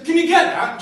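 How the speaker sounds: loud, high call from another room; host calling from the kitchen. Acted. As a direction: call through the house.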